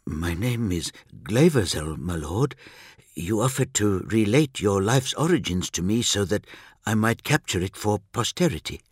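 nervous scholar in an altered voice; nervous Remembrancer. A narrator's reading. Remembrancer bowing before the Primarch, nervous. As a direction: introduce yourself nervously, in a character voice unlike the narrator's. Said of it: "The Remembrancer bowed nervously".

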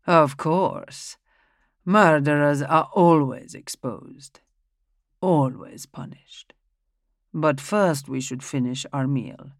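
put-on an old patriarch who cannot be ruffled; Schedoni, calm old man. Old man speaks at dinner.

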